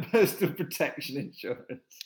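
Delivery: loud, laughing punchline. Real, not acted. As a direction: crack up while getting the words out. Laughs at own stumbled joke.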